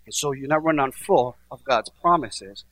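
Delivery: ordinary talk, nothing marked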